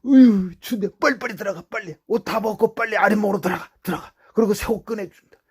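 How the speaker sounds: fast, high and loud fussing; hurrying mother. Retold or imitated. Mother hurrying her cold son to the warm floor, fast and high. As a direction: rush him inside like a mother.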